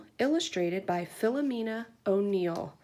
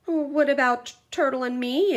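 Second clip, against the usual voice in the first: asking mouse; 6 semitones higher, 4 dB louder than usual. A narrator's reading. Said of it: "asked Mouse".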